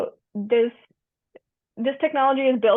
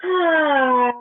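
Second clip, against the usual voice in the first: high, louder imitated "Ah" of a classmate letting go; imitating a yoga groan; 5 semitones higher, 6 dB louder than usual. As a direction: mimic someone's loud release noise in class. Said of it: "there was that one person who was like".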